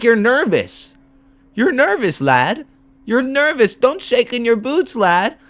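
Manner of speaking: high-pitched taunting caller; mocking caller impression. Streamer replaying the caller's taunts about nerves, high mocking voice. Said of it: "He's like".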